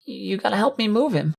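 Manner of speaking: put-on tearful, high-pitched child's whimper; pleading little colt near tears; Rumble. A narrator's reading. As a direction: beg in a small, high whimper. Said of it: "a tearful Rumble was whimpering, begging".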